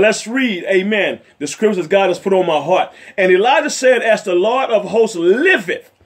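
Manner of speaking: ordinary delivery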